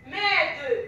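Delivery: normal talking voice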